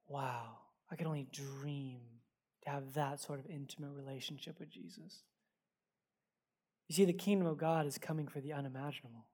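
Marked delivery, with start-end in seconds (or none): 0.1-5.2 much softer and lower, almost breathless with awe; awed hush. Retold or imitated. Pastor recalling his awe after the homeless lady, hushed. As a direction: let it fall away in quiet wonder.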